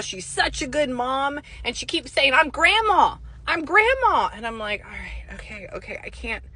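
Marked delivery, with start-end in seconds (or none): 2.3-4.3 higher, louder retold insistence; insistent spirit. Grandmother's spirit pestering the psychic, insistent. 4.8-6.4 much softer, under the voice; reluctant brush-off. Retold or imitated. Psychic to a pestering spirit, quietly reluctant.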